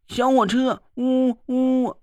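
put-on high childish doll with train toots; happy doll call. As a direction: chirp it like a happy toddler playing train. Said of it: "泥娃娃高兴地叫了起来". (泥娃娃开心地叫)

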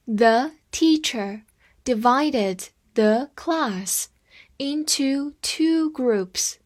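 slow, chunked phrase-by-phrase reading. Shown as a demonstration. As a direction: read it slowly in pieces for learners. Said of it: "我们再读一遍". (分段慢读)

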